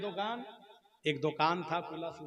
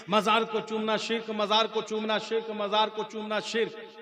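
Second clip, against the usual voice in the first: raised, droning repetition; haranguing cleric; 4 semitones higher, 4 dB louder than usual. Acted. Preacher mimics cleric's all-night sermon, droning harangue. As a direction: repeat it as one unbroken mock harangue. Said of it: "मौलवी रात भर तकरीर किया".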